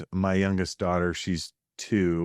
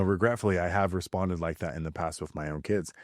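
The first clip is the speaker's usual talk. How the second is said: ordinary talk, nothing marked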